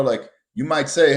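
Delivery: ordinary talk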